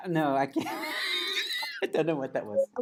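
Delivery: ordinary talk, nothing marked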